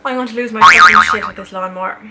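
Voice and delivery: loud exasperated rant; furious outburst. Real, not acted. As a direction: blow up at the noise. Fury at lawnmower.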